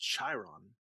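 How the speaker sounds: Chiron as "SHY-ron"; mocked podcast pronunciation. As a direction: slowly say Chiron with a soft "sh" and long i. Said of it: "It's pronounced".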